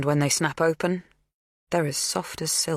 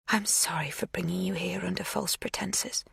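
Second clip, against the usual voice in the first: whispered, softer apology; tender whisper; 4 semitones higher, 6 dB quieter than usual. A narrator's reading. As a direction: confess it in a whisper. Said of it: "she says".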